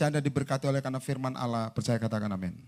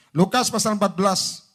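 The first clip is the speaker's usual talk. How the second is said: raised, projected reference; firm announcement; 3 semitones higher, 6 dB louder than usual. Real, not acted. Preacher announcing the verse to congregation, loud and firm. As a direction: call it out for all to hear.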